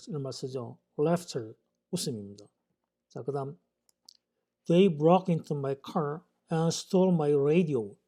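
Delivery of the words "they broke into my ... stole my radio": very slow, careful English sentence; slow example reading. Shown as a demonstration. English teacher reading an example sentence slowly to students. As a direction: read each English word slowly for learners.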